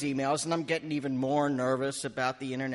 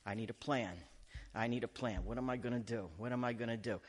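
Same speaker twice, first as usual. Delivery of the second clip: hushed, lower-pitched fretting to himself; antsy self-talk; 4 semitones lower, 9 dB quieter than usual. Retold or imitated. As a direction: think aloud softly, mock-nervous. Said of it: "And I'm getting antsy".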